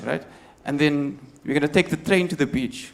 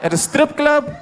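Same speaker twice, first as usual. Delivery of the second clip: much higher, louder incredulous snap; loud, laughing, exasperated; exasperated retort; 10 semitones higher, 4 dB louder than usual. Retold or imitated. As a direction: fire it back in disbelief. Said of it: "I'm like".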